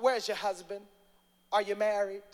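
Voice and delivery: a hint of a probing prophet; mock biblical prophet. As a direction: ask like a nosy seer. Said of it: "That's how the prophets do".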